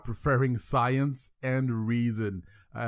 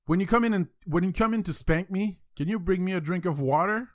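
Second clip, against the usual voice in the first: put-on high-pitched little boy voice; cheeky kid; 7 semitones higher than usual. Boy bargains with dad over the spanking, cheeky kid. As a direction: ask it innocently, pitched up like a child.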